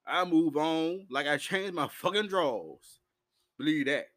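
ordinary delivery

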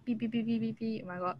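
ordinary talk

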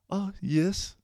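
high-pitched imitation French accent; mock French-accented girl voice. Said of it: "I can always hear that, that French accent".

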